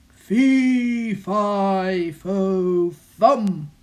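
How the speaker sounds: loud booming roar; angry giant roar. Acted. Angry giant chasing the boy, loud booming roar. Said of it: "roared the angry giant".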